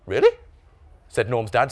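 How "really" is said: very high rising question; slightly surprised. A narrator's reading. Dad doubting Norm's answer, surprised. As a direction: pitch shoots up in disbelief. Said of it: "slightly surprised".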